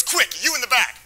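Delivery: high urgent call to the back seat; urgent order. Retold or imitated. Friend urgently ordering the narrator in back, high and urgent. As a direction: snap it out like an emergency.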